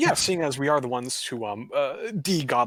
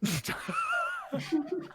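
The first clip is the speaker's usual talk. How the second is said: high giggle; 6 dB quieter than usual. Real, not acted. Table cracks up at the bit.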